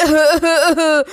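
gloating laugh; mock the victims with a laugh; villain laugh. The thief laughs at the family he robbed, gloating.

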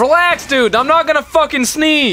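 раздражённо, громко и высоко. Real, not acted. Irritated denial, loud.

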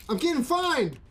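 high, loud dismayed cry; excited outcry. Real, not acted. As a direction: cry out in disbelief. Game fine surprise.

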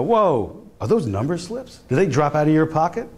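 high, fake innocent surprise; mock surprise. Cop feigning surprise to a numbers runner, mock shock. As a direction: play the cop pretending to find the slips.

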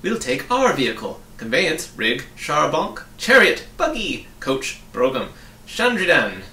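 loud, emphatic list of synonyms; rattled-off list. A narrator's reading. Cabinet members naming their vehicle in turn, loud and emphatic. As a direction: punch out each word loudly, one after another. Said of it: "they repeated quickly in order".

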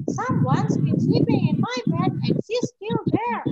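a little bear alarmed; baby bear voice. Acted. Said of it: "Baby Bear said".

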